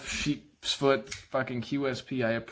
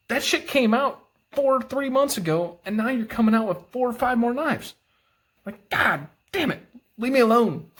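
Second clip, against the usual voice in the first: exasperated rant; 9 semitones higher than usual. Real, not acted. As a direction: throw up your hands at yet another release. Exasperated at constant new releases.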